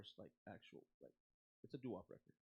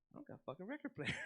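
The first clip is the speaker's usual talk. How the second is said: higher, laugh creeping into the quote; laughing punchline; 7 semitones higher than usual. Retold or imitated. Singer phoning the fan about the record, retold laughingly. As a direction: imitate the complaint, cracking up.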